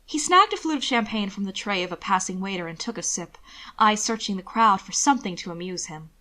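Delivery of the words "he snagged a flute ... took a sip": ordinary delivery, nothing marked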